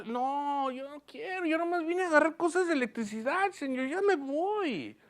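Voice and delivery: a man backing out of the Lord's request under his breath; reluctant excuse. Retold or imitated. Preacher voicing himself dodging God's call, softly and reluctantly.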